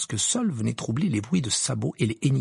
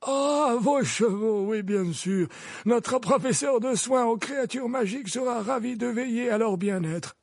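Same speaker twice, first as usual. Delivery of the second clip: put-on much higher, amused old man; old wizard voice; 12 semitones higher than usual. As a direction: realise and reply brightly. Dumbledore voiced.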